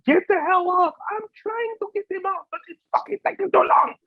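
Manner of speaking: high, strained mock yell; yelling producer. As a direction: play a frantic producer calling out. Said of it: "the producer's telling you".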